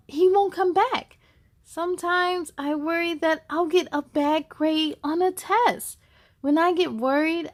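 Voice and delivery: ordinary delivery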